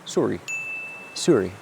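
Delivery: a meek little Canadian "Sorry"; mock Canadian sorry. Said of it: "what's the Canadian thing to say?".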